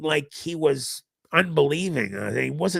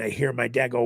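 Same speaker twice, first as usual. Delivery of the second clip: ordinary delivery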